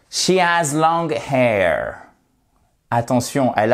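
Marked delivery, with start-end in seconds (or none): 0.1-2.0 slow, careful English model sentence; slow model phrase. Shown as a demonstration. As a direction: spell it out slowly. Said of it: "on dit hair en anglais". (phrase modèle lente et articulée)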